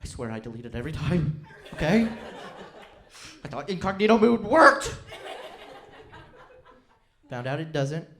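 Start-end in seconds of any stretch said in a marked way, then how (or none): 0.0-4.9 mock panic. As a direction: beg them not to look, hamming the panic. Panicked plea about search history.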